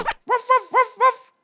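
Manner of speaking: high, loud, cross little-dog barks; angry yapping. A narrator's reading. Little dogs barking at escaping mice, angry yapping. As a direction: yap furiously like small dogs. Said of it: "keften de hondjes nijdig".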